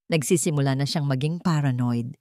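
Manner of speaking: ordinary delivery, nothing marked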